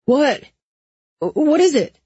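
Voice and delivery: high, alarmed questioning; urgent, high-pitched alarm. A narrator's reading. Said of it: "Owen's alarmed voice said".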